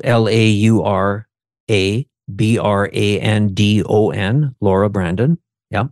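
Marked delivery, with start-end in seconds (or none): 0.0-4.5 slow, letter by letter; spelled out. Real, not acted. Host spells the guest's name for listeners, spelled out.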